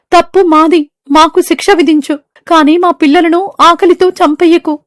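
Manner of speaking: high, begging voice; pleading bird. Acted. Birds begging the great tree for mercy. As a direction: plead for your children's lives.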